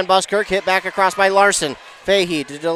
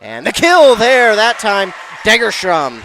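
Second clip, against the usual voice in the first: loud, raised, slowed play-by-play burst; excited kill call; 4 semitones higher, 7 dB louder than usual. Real, not acted. Commentator calls a kill live.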